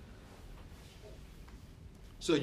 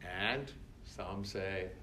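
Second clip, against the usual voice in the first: normal talking voice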